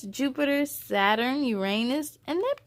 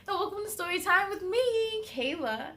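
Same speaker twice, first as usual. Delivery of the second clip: cheery welcome; 8 semitones higher than usual. Acted. Host's bright welcome.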